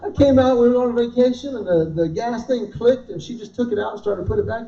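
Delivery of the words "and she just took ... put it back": ordinary talk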